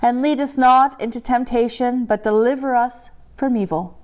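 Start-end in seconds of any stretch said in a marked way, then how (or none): none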